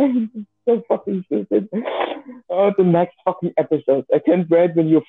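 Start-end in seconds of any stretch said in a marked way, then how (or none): none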